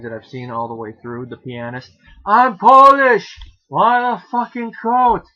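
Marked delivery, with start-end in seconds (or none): 2.3-5.2 loud, high, slow outburst; indignant yell, drawn out and high; shouted movie quote. Acted. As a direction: bellow it like the film scene. Quoting The Pianist scene.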